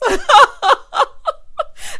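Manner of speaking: loud, high cackle; real high-pitched laughing fit; big laugh. Real, not acted. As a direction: burst out laughing, loud and long. Cracks up at Karen's line.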